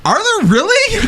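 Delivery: incredulous. Acted. Incredulous challenge.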